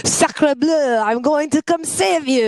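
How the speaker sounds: hammed-up French accent, high; mock French. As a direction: play a cheesy French action hero. Playing a French hero.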